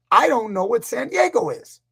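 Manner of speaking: high mock wail; hammed-up helpless confusion; retold tearful protest. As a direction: plead it high, imitating a meltdown. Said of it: "started crying".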